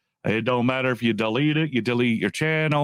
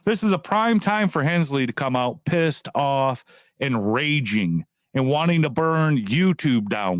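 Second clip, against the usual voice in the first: ordinary delivery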